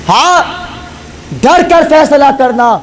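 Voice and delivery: fervent shouted warning; emphatic cry. Acted. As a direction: hammer the warning home. Preacher's final emphatic cry.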